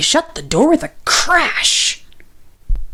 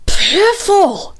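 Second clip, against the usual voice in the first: angry shout; 9 semitones higher than usual. A narrator's reading. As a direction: spit the word out in anger. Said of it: "shouted Grumpy Badger".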